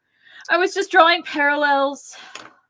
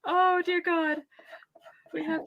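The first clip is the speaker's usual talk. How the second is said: laughing; 9 semitones higher, 3 dB quieter than usual. Real, not acted. Laughing hard at the voice.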